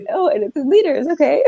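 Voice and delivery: high, giggly sheepish realisation; retold embarrassment. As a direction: re-enact the embarrassed penny-drop, half laughing. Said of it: "we were so embarrassed".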